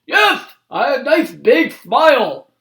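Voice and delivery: put-on high, loud old-man request; Grandpa asking. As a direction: ask the officer as toothless Grandpa. Grandpa asks officer.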